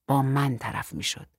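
plain reading voice

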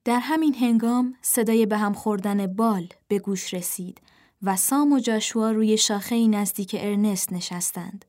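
ordinary delivery

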